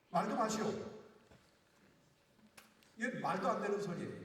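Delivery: normal talking voice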